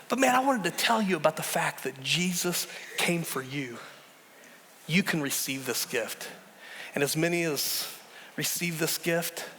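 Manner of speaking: ordinary talk, nothing marked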